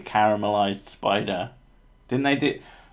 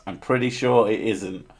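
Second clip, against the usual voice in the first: ordinary talk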